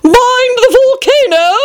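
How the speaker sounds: high, slow queenly cry of warning. A narrator's reading.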